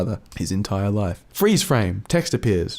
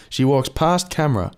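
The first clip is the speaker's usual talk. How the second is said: normal talking voice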